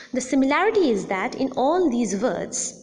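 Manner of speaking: usual speaking voice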